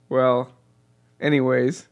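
usual speaking voice

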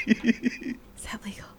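hushed slow whisper; sneaky whispered aside. Real, not acted. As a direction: breathe the question secretly.

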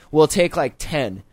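normal talking voice

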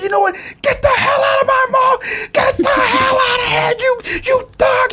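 very high, strained yell; hammed-up tirade at the top of the range; yelling security guard. Mall security guard throwing someone out of the mall, yelling. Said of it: "He was yelling at me".

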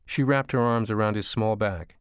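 usual speaking voice

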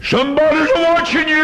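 preacher warning the congregation; preaching at full force. Acted. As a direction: call it out to the whole church. Closing sermon cry.

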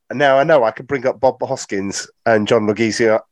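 ordinary delivery

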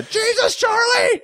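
very high panicked shout; girl freaking out. Retold or imitated. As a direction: scream it in panic. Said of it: "the girl freaking out".

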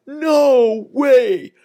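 high, loud cry of disbelief; excited shout. Retold or imitated. Van driver spots the pet pig and shouts in disbelief. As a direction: yell it out a van window in amazement. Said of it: "out of the window you just hear a".

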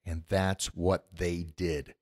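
And that's what they did, said slowly and softly, low and quiet; podcast host sums up the comeback, slow and hushed; slow, hushed emphasis. Real, not acted. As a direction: let it land, slow and low. Repeats the point for weight.

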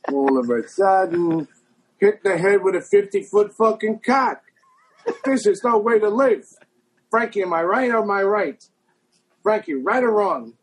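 a New York gangster ranting, high and indignant. Retold or imitated. Gangster ranting to Frankie on a bugged tape, high indignant wiseguy voice. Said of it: "who's doing the voices".

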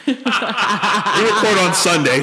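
high, slow, laughing through it. Real, not acted. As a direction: laugh while saying it. Laughing about dead wrong prediction.

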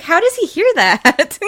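high, slow, laughing question; laughing disbelief. Retold or imitated. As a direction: squeal it out in amused disbelief. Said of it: "I was like".

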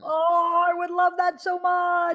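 high, drawn-out squeal of longing; gushing delight. Real, not acted. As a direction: coo it high with sheer delight. Delighted at DVD exchange idea.

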